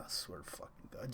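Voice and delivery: whispered curse under breath. Real, not acted. Streamer just beaten, whispering in despair. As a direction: hiss the oath to yourself. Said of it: "after losing live".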